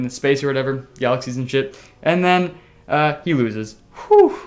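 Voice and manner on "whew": whoosh exhale. Acted. Dramatic exhale in story.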